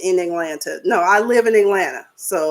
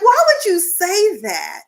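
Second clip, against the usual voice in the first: high, slow incredulous question; shocked; 7 semitones higher, slower than usual. Retold or imitated. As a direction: ask it in stunned disbelief. Own shocked reaction quoted.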